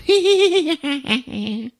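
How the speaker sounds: high-pitched doll giggle; giggling dolls. Retold or imitated. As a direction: giggle like a roomful of little dolls. Dolls laughing all around the room.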